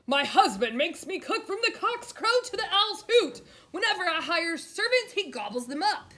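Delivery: loud, high giantess voice complaining; a big woman wailing about her husband; booming giantess. A narrator's reading.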